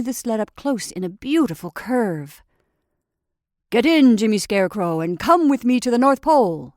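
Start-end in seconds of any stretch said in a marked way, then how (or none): 3.7-6.7 loud hearty invitation; cried out. Acted. Santa invites the scarecrow into the sled, loud hearty call. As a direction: call him into the sled, booming and glad. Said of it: "he cried".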